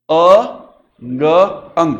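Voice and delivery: अ, ग apart, then joined as अंग; sounding out. Shown as a demonstration. Teacher breaking a word into sounds for pupils, deliberate. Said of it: "अब यहां आप पढ़ रहे हो".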